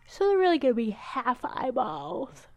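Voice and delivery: talking through a yawn; words stretched by a yawn. Real, not acted. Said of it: "Sorry for my yawn".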